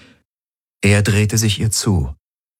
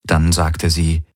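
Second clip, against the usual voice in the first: ordinary delivery, nothing marked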